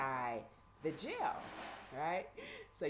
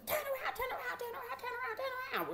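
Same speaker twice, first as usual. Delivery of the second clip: very high, relentless repetition; high-pitched mock hollering; imitated nagging orders; faster than usual. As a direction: hound them like a pushy guard. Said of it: "screaming and hollering at us".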